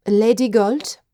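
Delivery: put-on female caller with a French accent; French-accented telephone query. A narrator's reading. French caller ringing Lady Galt, French accent. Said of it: "female with a French accent".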